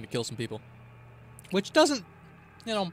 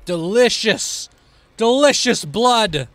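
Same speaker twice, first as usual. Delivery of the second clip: slow, loud, higher savouring; relishing villain; 5 semitones higher, 7 dB louder, slower than usual. Acted. Killer collecting blood, slow gloating relish. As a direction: draw it out with greedy relish.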